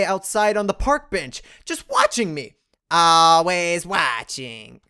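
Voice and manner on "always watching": slow ominous creepy voice; creepy slow whisper. Pretend. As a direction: draw it out, eerie and slow. Creepy remark about watcher.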